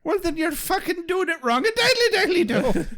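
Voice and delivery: high, chirpy cartoon neighbour; sing-song goofy neighbour. Silly Flanders-style voice.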